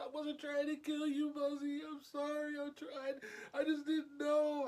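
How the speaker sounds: high-pitched crying voice, imitated; tearful sister. Sister bawling as she apologises to sibling, high tearful mimicry. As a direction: imitate a sobbing child blurting an apology. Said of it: "she is just bawling and crying".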